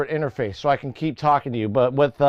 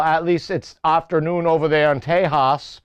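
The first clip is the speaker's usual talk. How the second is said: usual speaking voice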